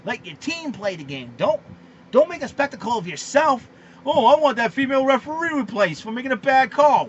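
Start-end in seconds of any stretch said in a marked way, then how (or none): 4.0-7.1 loud, mocking whiny complaint; hammed-up demand to replace the referee; imitating LeVar Ball. Mocking LeVar Ball demanding a new referee, loud and whiny. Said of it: "That's how ... LeVar Ball sounds like".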